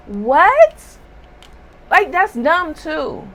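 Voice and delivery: high, loud disbelieving what; incredulous. Real, not acted. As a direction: react in disbelief. Incredulous reaction.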